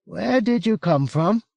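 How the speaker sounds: high-pitched, startled Englishman; well-spoken English voice pitched high in wary disbelief; fearful disbelief. A narrator's reading. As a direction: ask it high, afraid to believe it. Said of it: "with a mingling of fear and disbelief".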